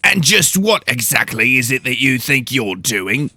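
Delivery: stern house steward growling a challenge. Acted. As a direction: growl at the intruders. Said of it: "the large woman asked in a growl".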